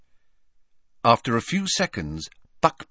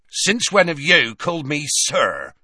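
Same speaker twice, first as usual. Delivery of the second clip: loud, high indignant challenge ending on "sir"; indignant Hagrid; 7 semitones higher, 5 dB louder than usual. A narrator's reading. Hagrid stunned at being called sir, indignant. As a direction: throw "sir" back in disbelief.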